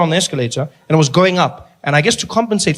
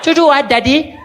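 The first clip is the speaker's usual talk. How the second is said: put-on child voice; 8 semitones higher than usual. Child voice asking father.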